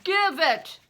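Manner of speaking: loud, high roar. A narrator's reading. Bear roaring at Crispin for the cross, loud roar. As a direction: bellow the order. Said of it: "he roared".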